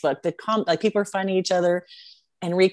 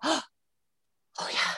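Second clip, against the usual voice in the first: higher, whispered delight; breathless whisper; 8 semitones higher, 8 dB quieter than usual. Retold or imitated. Host imitating the moment of recognizing an old song, whispered. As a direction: breathe it out as a hushed memory.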